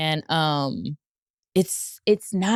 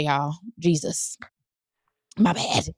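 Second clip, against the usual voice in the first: normal talking voice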